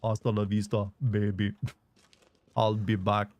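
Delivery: low, flat robotic Austrian Arnold; deadpan Terminator in Austrian accent; cold robotic Austrian accent. Retold or imitated. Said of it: "in Arnold voice".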